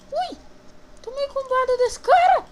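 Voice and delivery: high, loud outraged yelp; surprised shout. Real, not acted. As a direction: cry out as the hits land. Gets combo-hit in game.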